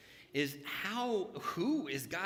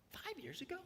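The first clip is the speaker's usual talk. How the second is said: incredulous high squeak of a question; asking in disbelief; surprised question; 13 semitones higher, 11 dB quieter, faster than usual. Retold or imitated. Recalling a friend's question, in a high surprised squeak.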